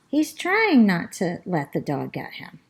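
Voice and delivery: ordinary delivery, nothing marked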